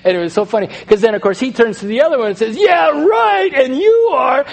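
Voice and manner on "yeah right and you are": high, loud retort; imitated buddy's loud comeback; mock accusing. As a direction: throw the accusation back, hammed up. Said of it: "watching sinners accuse each other".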